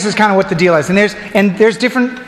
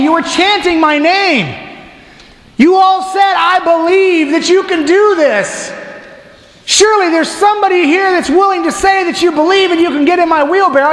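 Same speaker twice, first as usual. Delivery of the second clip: pleading showman; 8 semitones higher than usual. Retold or imitated. Blondin pleads with the crowd.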